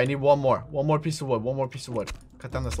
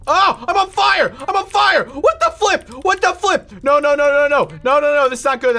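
panicked shout; 8 semitones higher, 6 dB louder than usual. Real, not acted. Character caught fire.

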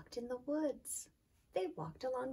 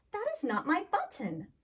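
louder, higher cry of protest; upset cry; 5 semitones higher, 6 dB louder than usual. Acted. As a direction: protest the wrong button. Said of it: "cried Toad".

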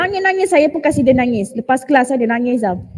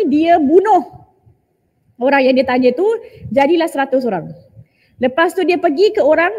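usual speaking voice

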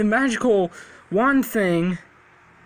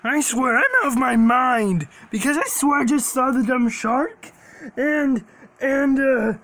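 high, drawn-out exclamations, worked up; indignant character voice pitched well above usual; frantic shark hunter; 9 semitones higher than usual. Acted. Hunter who lost sight of the dumb shark, frantic.

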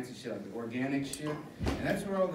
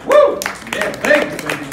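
loud, high party cheer; excited whoop; 7 semitones higher, 18 dB louder than usual. Real, not acted. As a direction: whoop it up for the crowd. Hyping crowd about Vegas draft.

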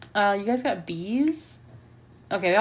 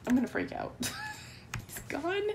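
ordinary delivery

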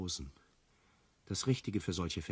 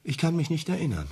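higher and louder defensive outburst; loud protest; 9 semitones higher, 9 dB louder than usual. Acted. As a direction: fling it back at her. Heated argument about his parents.

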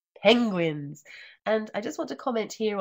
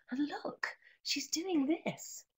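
high, soft, slow whisper; a secret whisper to the class; hushed; 10 semitones higher, 4 dB quieter, slower than usual. Acted. Showing picture secretively.

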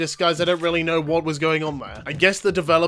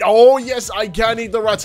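suddenly higher and much louder, thrilled; excited; 4 semitones higher, 7 dB louder than usual. Real, not acted. Gamer thrilled to find his cat can eat the rats, excited cheer. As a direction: cheer the moment you can finally win.

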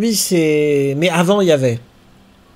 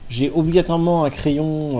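usual speaking voice

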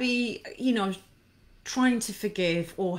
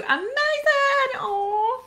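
high, slow, drawn-out gush; gushing amazing; 4 dB louder, much slower than usual. Real, not acted. Host cooing at a viewer doing well, gushing. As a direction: coo over their good news.

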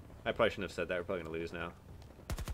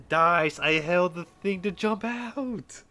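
high, loud, slow protest; frustrated gamer gripe; exasperated complaint; 7 semitones higher, 6 dB louder, slower than usual. Real, not acted. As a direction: whine at the developer. Complains to the game developer.

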